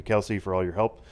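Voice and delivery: normal talking voice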